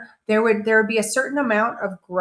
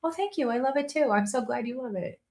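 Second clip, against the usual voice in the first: gracious thanks; 3 semitones higher, 6 dB quieter than usual. Shown as a demonstration. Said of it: "Let's just say".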